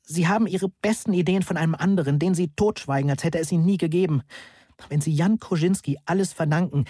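narrated outburst, voice lifted in indignation; angry tirade. A narrator's reading. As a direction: fire off accusations without really shouting. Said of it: "was ich genau brüllte".

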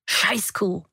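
whispered "Scheißkuh" with a falling end; hissed insult. A narrator's reading. Mother, head on the table, hisses at her daughter. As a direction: spit "Scheißkuh" out breathlessly. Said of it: "fauchte Mama in den Tisch".

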